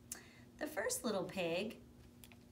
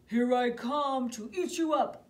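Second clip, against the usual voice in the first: very loud, far-off wolf boast; gleeful wolf; 7 semitones higher, 14 dB louder than usual. Acted. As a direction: roar it as you jump in. Wolf climbs down chimney.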